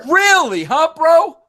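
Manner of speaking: high, loud disbelieving question; taken aback by his pick; real surprise. Real, not acted. Host reacts to guest's choice.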